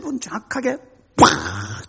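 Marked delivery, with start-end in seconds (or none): 1.2-1.9 low, breathy crash burst; imitated impact. Preacher imitating the crash as the elder escapes, breathy burst. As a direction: draw 팍 out into an airy blast.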